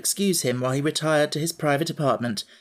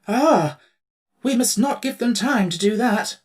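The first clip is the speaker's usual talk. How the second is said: much higher, louder hurried emperor; quick, urgent; 8 semitones higher, 4 dB louder than usual. Acted. Emperor alarmed at the coming march, urgent. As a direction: rush to act. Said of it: "said the Emperor quickly".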